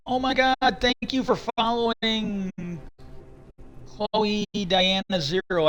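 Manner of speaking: high, drawn-out thank-you; delighted gratitude. Real, not acted. Streamer breaks off to thank a new follower, delighted gratitude.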